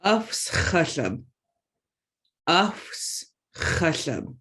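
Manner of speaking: "Afs chashav" slow, with a rough ch blown out; teaching pronunciation. Shown as a demonstration. Teacher models allergic word slowly to the class. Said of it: "make sure you're making that ch sound".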